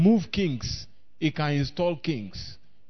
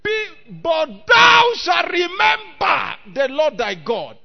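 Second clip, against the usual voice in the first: high, loud shouted preaching with stretched key words; full-force preaching; 8 semitones higher, 9 dB louder than usual. Acted. Preacher crediting God over human skill, full-force shouted preaching. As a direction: thunder the first phrase, drop for each contrast.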